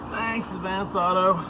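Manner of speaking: dripping with mock gratitude; sarcastic. Sarcastic thanks to parts store.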